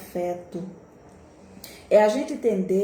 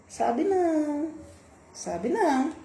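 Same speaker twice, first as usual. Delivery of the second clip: high, slow, knowing no; drawn-out scornful "sabe não"; emphatic denial; 6 semitones higher, slower than usual. Real, not acted. (negação enfática, lenta e aguda)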